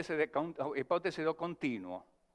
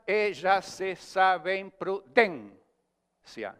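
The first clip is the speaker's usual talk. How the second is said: very slow verse, stress pressed onto the seventh syllable; scanned metre demo; 3 semitones higher, 3 dB louder, much slower than usual. Shown as a demonstration. Teacher showing the class a line that ends on an unstressed syllable, very slow. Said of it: "quando não termina em palavra aguda".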